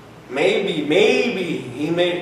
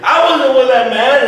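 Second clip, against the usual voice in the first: loud, higher angry denial; mad denial; 7 semitones higher, 9 dB louder than usual. Retold or imitated. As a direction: snap it out defensively. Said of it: "he got mad".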